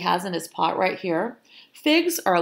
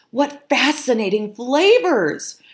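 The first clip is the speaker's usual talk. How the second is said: higher, louder delighted exclamation; excited cry; 7 semitones higher, 6 dB louder than usual. A narrator's reading. Young foxes praising the food, excited cry. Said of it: "cried Freddie and Flo".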